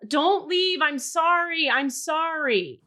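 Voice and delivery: high, loud pleading that slows and fades on the last sorry; imitating the woman yelling after the man; retold desperate yell. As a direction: beg him not to leave, then trail off. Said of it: "And she's yelling".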